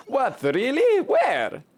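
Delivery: high-pitched startled questions; surprised. Acted. Villager hears of a ghost sighting, surprised. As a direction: blurt it high, amazed by the news.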